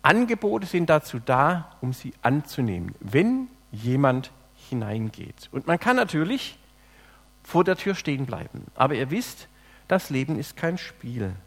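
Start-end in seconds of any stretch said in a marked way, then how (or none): 3.0-5.5 slow, with "wenn" drawn out and stressed; weighty "wenn", pauses before the words that follow. Shown as a demonstration. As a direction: hit "wenn", then place each following word separately. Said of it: "Ich lege einmal die Betonung auf das erste".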